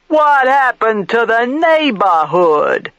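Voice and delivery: hammed-up New York Italian, slow, loud and high; exaggerated New York Italian. A narrator's reading. Marino mocking the neighborhood in a New York Italian accent. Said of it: "He exaggerates a New York Italian accent".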